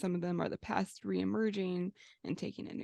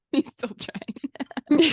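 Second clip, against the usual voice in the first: loud, slow, laughing through it; 6 semitones higher, 13 dB louder, much slower than usual. Real, not acted. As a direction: giggle out the tease. Teasing laugh at host.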